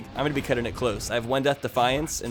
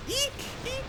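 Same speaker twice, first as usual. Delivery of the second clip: very high tiny mouse squeaks; squeaking like a mouse; 8 dB quieter than usual. Acted. Squeaks at game enemy.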